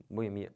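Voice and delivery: "Boemia" as bo-e-MI-a; wrong stress on MI. Shown as a demonstration. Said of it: "Mas tá errado". ("Boemia" com a tônica no MI)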